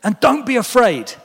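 high, loud call of comfort over the waves; reassuring call, high and loud; Jesus calling out. A narrator's reading. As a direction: call out to reassure terrified disciples. Jesus voiced in story.

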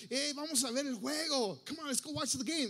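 high-pitched excited invite; rally a friend to come along; eager invitation. Acted. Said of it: "te invitan".

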